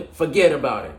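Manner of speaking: put-on loud, high-pitched New York accent. Football host hamming up his best New York accent. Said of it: "in my best New York accent".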